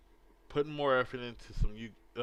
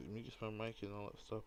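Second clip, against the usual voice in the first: ordinary talk, nothing marked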